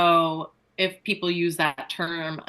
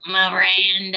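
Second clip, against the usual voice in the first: cartoon impression on request; Roz from Monsters, Inc; 4 dB louder than usual. Shown as a demonstration. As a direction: drone the name in character. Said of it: "Can you say Miranda in that voice?".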